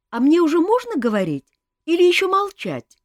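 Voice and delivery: put-on high, loud boyish question; timid boy. A narrator's reading. Young prince asking if he may speak yet, timid. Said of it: "робко подал голос Мафей".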